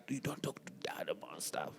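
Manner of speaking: whispered warning to a sister. Retold or imitated. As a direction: hush it like a secret. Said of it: "she's like".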